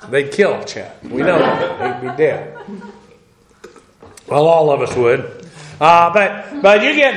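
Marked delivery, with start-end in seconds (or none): 0.1-2.6 laughing. Real, not acted. Joke about Chet getting drafted.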